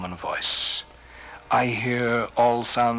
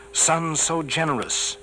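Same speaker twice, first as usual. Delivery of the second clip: usual speaking voice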